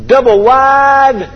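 "double wide" stretched long and high; comic drawn-out emphasis. Acted. Comic build-up to absurd ownership claim.

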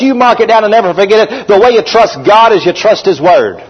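normal talking voice

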